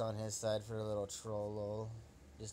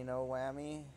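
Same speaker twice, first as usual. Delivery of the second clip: ordinary delivery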